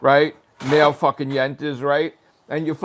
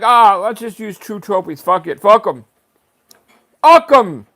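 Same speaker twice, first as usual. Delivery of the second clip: higher and louder, each fuck 'em harder; mock dismissive rant; 7 semitones higher, 9 dB louder than usual. Vlogger imitating leaderboard people ditching the site, louder each time. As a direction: brush them off, getting louder.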